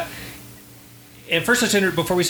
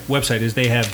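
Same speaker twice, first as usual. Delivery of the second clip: ordinary talk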